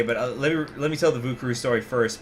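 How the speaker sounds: normal talking voice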